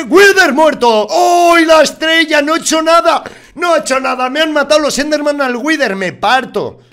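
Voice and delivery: very high, loud, stretched shouting in disbelief; gritos de asombro. Real, not acted. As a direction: shriek with amazed outrage. El jefe muere sin él. (gritos incrédulos al ver morir al Wither)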